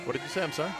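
ordinary talk

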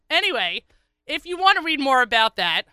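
ordinary talk

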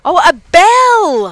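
high, loud, drawn-out "oh" of getting it; sudden realization. Real, not acted. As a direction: finally catch the word, delighted. Realizing misheard word bell.

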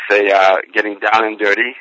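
ordinary talk, nothing marked

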